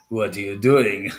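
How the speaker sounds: ordinary talk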